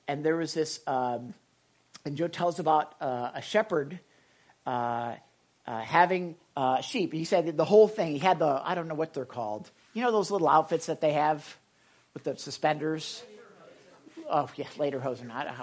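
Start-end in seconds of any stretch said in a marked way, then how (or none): none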